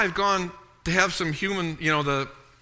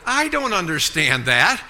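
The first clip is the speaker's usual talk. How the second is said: usual speaking voice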